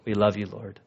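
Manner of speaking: low, subdued congregational prayer. Real, not acted. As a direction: lead them in prayer, pitched low and even. Leads a closing prayer.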